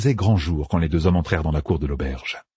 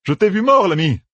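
much higher, startled cry at a dead man; shocked outburst; 14 semitones higher, 4 dB louder than usual. A narrator's reading. Matt sees the innkeeper he saw dead, shocked. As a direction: blurt it out as if seeing a ghost. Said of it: "souffla Matt, soudain blafard".

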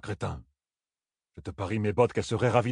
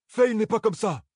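sudden high outburst, louder; cry out; 10 semitones higher, 4 dB louder than usual. Acted. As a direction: blurt it out in protest. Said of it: "s'écria Perrine".

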